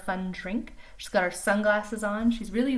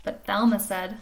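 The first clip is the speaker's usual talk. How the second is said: lively storybook reading voice; animated picture-book narration; reading to children; 5 semitones higher, 5 dB louder than usual. A narrator's reading. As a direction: read it like story time for little kids. Reads picture book aloud.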